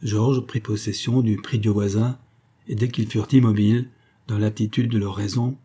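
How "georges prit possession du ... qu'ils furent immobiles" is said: ordinary delivery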